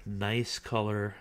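ordinary delivery

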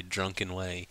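ordinary delivery

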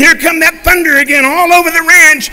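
loud and high storytelling; booming storm narration; dramatic storm. A narrator's reading. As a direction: paint the storm big and loud. Storm rolls over ranch.

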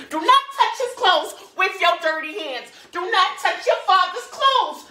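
very high, loud scolding; mother's shrieked order. Acted. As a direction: screech it at the child, hammering each word. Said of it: "I just hear my mama saying".

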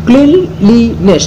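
Cleanliness slowly, as clean, লি, নেস; split in three. Shown as a demonstration. Teacher counting out syllables, cleanliness in three slow parts. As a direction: break cleanliness into three beats. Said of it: "তিনটে ভাগে ভাগ করছি".

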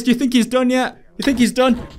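ordinary talk, nothing marked

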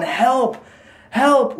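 high mock yell; imitated cry for help. Telling a friend what was heard outside, imitated yell. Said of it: "as loud as they could".

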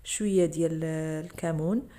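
normal talking voice

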